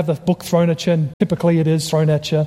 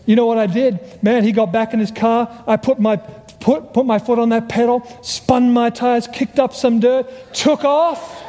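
higher-pitched, hammed-up getaway boast, slowing for effect; mock bravado; 5 semitones higher than usual.

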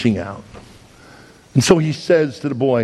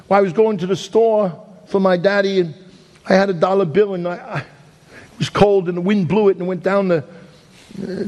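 put-on a little boy explaining, pitched higher; little boy's voice; 5 semitones higher than usual. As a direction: tell it as the boy, a bit higher and simple. Preacher voices crying boy's story.

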